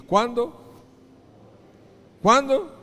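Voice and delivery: loud, high rising question; prompting the congregation. Real, not acted. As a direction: call it out for the crowd to answer. Preacher prompts congregation to answer. (pregunta fuerte y aguda al público)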